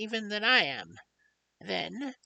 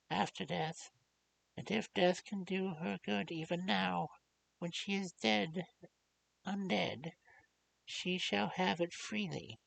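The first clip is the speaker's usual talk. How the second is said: full of pity. Acted. Old doctor pleads kindly with the grieving young lord. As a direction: beg him gently, heart aching. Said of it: "with an accent full of pity".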